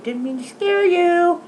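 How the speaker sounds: high-pitched pet talk; sweet singsong sorry; cooing apology. Real, not acted. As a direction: coo it high to soothe a startled pet.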